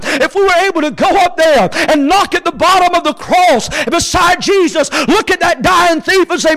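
high, loud, sustained preacher's cry; full-force preaching. Acted. Preacher calls out to the dying thief, full-force preaching. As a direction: preach it in long, ringing phrases.

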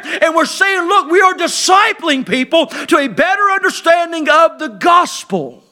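full-force preaching. Acted. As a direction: hammer each line home to the church.

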